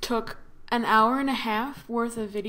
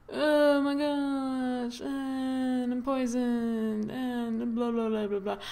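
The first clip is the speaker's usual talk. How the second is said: slow, hammy dying voice; milk the fake poisoning, slowly; overacted death scene; 2 semitones higher, 3 dB quieter, slower than usual. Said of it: "And I am super dramatic".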